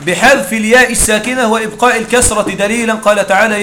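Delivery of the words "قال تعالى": ordinary delivery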